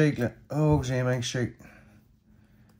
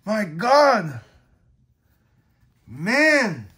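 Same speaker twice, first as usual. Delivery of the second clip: excited cheer; 5 semitones higher, 5 dB louder, much slower than usual. Real, not acted. Thrilled by card pull.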